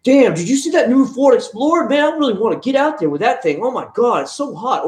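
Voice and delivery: mocking someone gushing over a family car; mock hyped young guy. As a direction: ham up a single guy drooling over an SUV. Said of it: "is not gonna be like".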